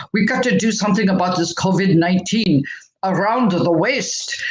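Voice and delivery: lightly put-on Belgian accent, a hint of Schwarzenegger. Health coach imitating Doctor Mike to her audience, mild Belgian accent. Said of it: "In his Belgian accent, you know, sounds like Doctor Schwarzenegger".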